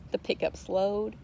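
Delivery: usual speaking voice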